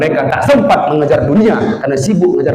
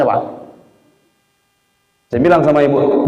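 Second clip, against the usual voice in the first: ordinary talk, nothing marked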